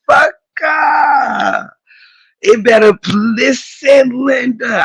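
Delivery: listen Linda, called out with shouts; worked-up repeated call. Real, not acted.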